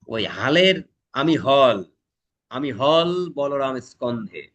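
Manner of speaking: slow, weighty recital of হল in আমি হল Balaram স্কন্ধে; slow poem recital. Shown as a demonstration. Teacher reciting Nazrul's line slowly for the class. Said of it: "poem line demonstrated".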